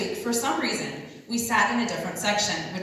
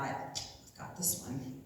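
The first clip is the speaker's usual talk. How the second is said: quiet; 6 semitones lower, 13 dB quieter, much slower than usual. Retold or imitated. Her private smug thought.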